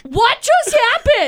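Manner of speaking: high, stretched-out mock disbelief; retold shock. And I was like.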